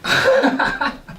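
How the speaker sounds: short breathy scoff, pitched high; scoffing laugh. Acted. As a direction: huff out a mocking laugh. Bitter laugh at her.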